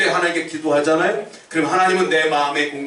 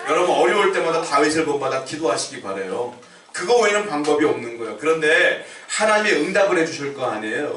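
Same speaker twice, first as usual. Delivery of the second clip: ordinary talk, nothing marked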